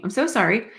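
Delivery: ordinary talk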